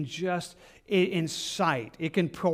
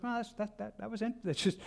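stammered quiet reaction; 3 semitones higher, 9 dB quieter than usual. Retold or imitated. Acted reaction to calm answer.

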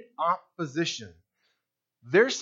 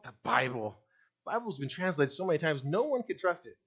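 softer, quicker, brushed-off dismissal; offhand dismissal; 4 semitones lower, 6 dB quieter than usual. Retold or imitated. Preacher recalling his younger self, softer offhand dismissal. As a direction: toss it away like it's not worth discussing. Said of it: "What was my dismissal?".

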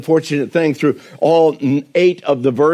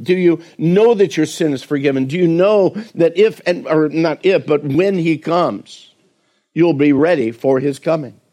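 ordinary talk, nothing marked

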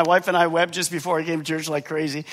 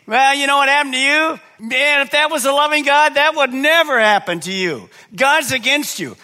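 higher, louder taunting tempter; Satan's taunt; 9 semitones higher, 7 dB louder than usual. Acted. Satan taunting a discouraged believer, mocking and loud. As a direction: needle them like the devil would. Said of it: "He loves to say".

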